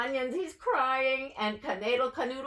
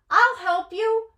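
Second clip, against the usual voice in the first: high, loud eager offer; 12 semitones higher, 8 dB louder than usual. Acted. Mendel eagerly offering help to the matzo man, high and loud.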